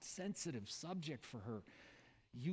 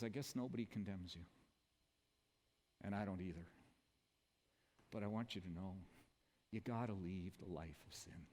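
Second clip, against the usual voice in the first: low, soft, gentle reassurance; Jesus softly speaking to the woman alone, low and tender; 5 semitones lower, 5 dB quieter than usual. Pretend. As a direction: say it quietly like forgiving a friend. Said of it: "He says".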